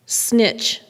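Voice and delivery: ordinary delivery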